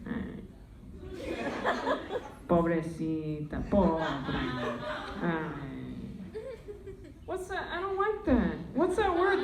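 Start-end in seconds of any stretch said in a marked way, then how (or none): 0.1-5.5 put-on low, soft old Spanish grandma voice; grandma impression. Comedian imitating her grandma cooing at her in Spanish, low and soft. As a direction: croon at the granddaughter like an old abuela. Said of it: "She's like".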